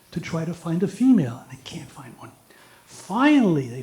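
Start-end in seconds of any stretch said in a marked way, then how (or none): 1.4-2.3 unvoiced hushed aside; whisper. Real, not acted. Telling the parrot joke, sudden whispered aside. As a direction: drop to a whisper. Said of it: "whisper heard".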